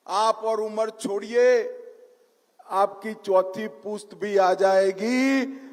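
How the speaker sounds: normal talking voice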